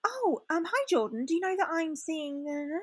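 mock her sugary-snide greeting; bitchy tone. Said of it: "in this really bitchy tone".

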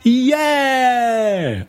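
high eager yell of yes; excited shout. Acted. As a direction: cheer it like thrilled kids. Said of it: "Og alle råber".

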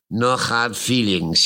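put-on slow German-accented delivery. Rival act draping an arm round the support act, German accent. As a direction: say it slowly in an imitated German accent. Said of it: "in an accent that Christopher Waltz would later adopt".

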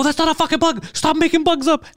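very high-pitched mock yelling; high mock fury at a coworker's fake bug; retold angry rant. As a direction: squeal it like you're losing your mind. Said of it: "I was just going crazy".

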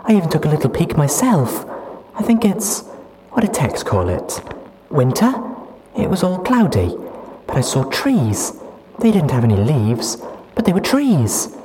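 giddy mare voice. Acted. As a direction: chatter happily about the future. Duct Tape excited.

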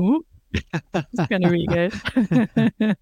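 slow, relishing ooh; a hint of a nosy spectator; gossipy onlooker. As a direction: savour the drama like popcorn. Popcorn-eating onlooker.

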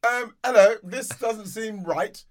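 high, slow prim posh voice. Pretend. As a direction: dawdle it out like a prissy toff. Continues the posh mock.

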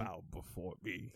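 low menacing villain line, laughing; dark lord. Acted. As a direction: growl it low like a smug boss. Quoting Geese trailer line.

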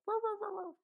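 high howled "roar" sounds; imitated dog howl. Storyteller mimicking the dog's howl growing nearer. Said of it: "It's like".

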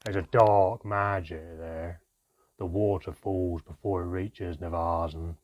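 slow, low, rough dwarf voice; gruff, ominous dwarf; grunting dwarf. A narrator's reading. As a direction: warn her off slowly in a gruff grunt. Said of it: "grunted the first dwarf".

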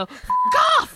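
loud shouted outburst; action hero shout. Acted. Action hero wannabe shooting at pigeons, shouting. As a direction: shout it like a one-line catchphrase. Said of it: "that's what I would be shouting constantly".

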